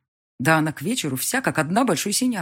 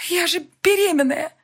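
higher, louder horrified cry; anguished wail; 13 semitones higher, 6 dB louder than usual. A narrator's reading. Elvira crying out she's pregnant, anguished. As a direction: wail in horror.

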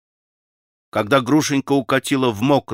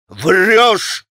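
loud, higher-pitched angry shout; вскричал; 6 semitones higher, 5 dB louder than usual. A narrator's reading. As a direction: shout the accusation, rolling the R. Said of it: "вскричал Митя". (яростный крик)

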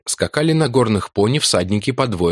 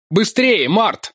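shouting; 12 semitones higher, much slower than usual. A narrator's reading. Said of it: "кричал я с разрушенной лестницы".

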